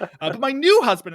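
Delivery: high, loud gushing voice. Acted. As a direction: gush with excitement. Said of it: "[name] is being like".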